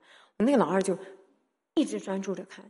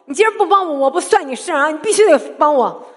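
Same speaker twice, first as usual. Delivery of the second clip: loud, high, insistent crying out to God; desperate demanding prayer; 7 semitones higher, 11 dB louder than usual. Retold or imitated. Mother praying for her sick son, loud desperate demand. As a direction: demand it, refusing to let go. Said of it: "掐着耶稣的脖子".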